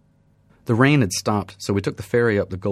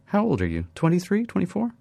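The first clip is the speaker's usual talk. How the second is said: curious guess; 11 semitones higher than usual. Acted. Woman guesses his age.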